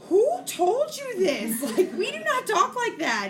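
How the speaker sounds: very high, loud mock-horrified mom; retold shocked scolding. Mom scolding son for his words, high loud mock shock. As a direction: replay a scandalized mom, voice shooting up high.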